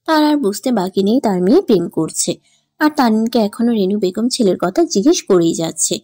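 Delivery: ordinary delivery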